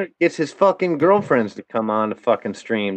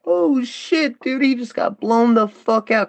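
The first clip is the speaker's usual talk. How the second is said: high, amazed mock gasp; retold shock; 12 semitones higher than usual. Chat members reacting to Eric getting blown out, high amazed mock exclaim. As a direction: sell the disbelief up high. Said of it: "we all just were like".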